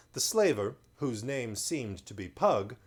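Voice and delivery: ordinary delivery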